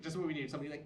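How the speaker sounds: normal talking voice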